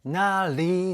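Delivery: なり with the り said as an English L; なり drawn out, its り turned into "li". Shown as a demonstration. Music reactor showing the group's technique, English L sound. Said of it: "のりとかもLの発音で".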